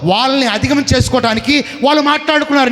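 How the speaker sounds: ordinary talk, nothing marked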